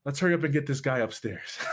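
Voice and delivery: quick, laughing doctor voice; a light mock doctor hurrying things; brisk doctor. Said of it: "He goes".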